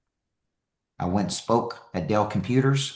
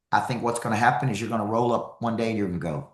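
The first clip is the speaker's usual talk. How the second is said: ordinary talk, nothing marked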